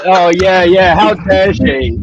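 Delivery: mock the friend's fake innocence; sarcastic mock outrage. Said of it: "He's like".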